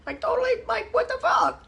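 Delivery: high, breathy mock squeal of outrage; mock outraged client. Trainer mimicking a client who gets mad at her, high squeal. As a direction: play the offended client, way up high. Mocking an angry client's reaction.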